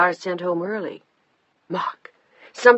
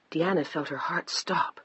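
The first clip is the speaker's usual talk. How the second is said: ordinary delivery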